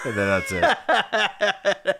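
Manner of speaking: laughing shout. Real, not acted.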